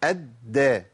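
أدى as slow "ad-da" with d held and no bounce; doubled dal, fatha. Shown as a demonstration. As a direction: hold the d of أدى, then open to "a". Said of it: "الدال المشددة بفتح". (أدى بدال مشددة مفتوحة بلا قلقلة)

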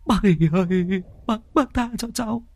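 put-on high, whining boy begging for mercy; whimpering plea. Thắng pleading with old Hỷ while being beaten, high whimpering. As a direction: beg like a sobbing child.

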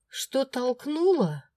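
high, slow, incredulous repeat; indignant outburst. A narrator's reading. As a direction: throw his words back at him. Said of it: "всё-таки не выдержала я". (возмущённо, медленно переспрашивая)

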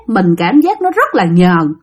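ordinary delivery, nothing marked

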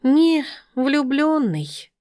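high, very slow drawn-out voice; animal character. A narrator's reading. Sima defending poor Sergei, high drawn-out voice. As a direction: stretch it out high, correcting gently.

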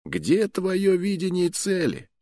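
high, slow, distant accusing question. A narrator's reading. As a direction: echo the challenge from memory. Remembered accusation from Figaro Fi.

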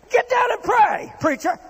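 high, loud barked order; a rough captain shouting an order; commanding shout. Acted. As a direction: yell it down at the preacher. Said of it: "a heathen captain commanding a preacher".

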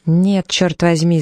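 ordinary delivery, nothing marked

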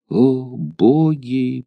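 drawn-out sigh. A narrator's reading. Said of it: "произнесла она".

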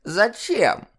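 высокий старушечий голос с хитрецой; хитро скрипни; хитрый скрип старухи. Acted. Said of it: "Хитро скрипнула диспетчер". (хитрая старуха)

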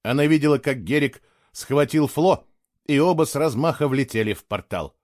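usual speaking voice

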